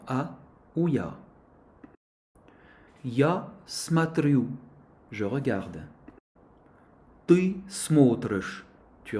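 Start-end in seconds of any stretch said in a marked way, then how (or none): 3.0-4.5 slow, carefully articulated teacher demo; model pronunciation drill. Shown as a demonstration. 7.3-8.6 slow, carefully articulated teacher demo; model pronunciation drill. Shown as a demonstration.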